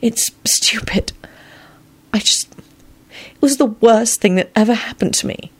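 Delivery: high, hushed whisper. A narrator's reading. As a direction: whisper it, close to tears. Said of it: "she whispered".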